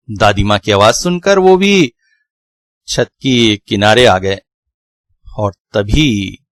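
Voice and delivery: ordinary delivery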